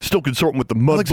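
mild character sneer; sneering taunt. A narrator's reading. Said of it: "Mordecai sneered".

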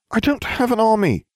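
high-pitched, smug denial; smirking. A narrator's reading. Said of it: "I smirked".

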